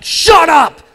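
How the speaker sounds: high, loud impulsive yell; retold shout. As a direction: blurt it out as a rude shout. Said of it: "he tells the teacher to shut up".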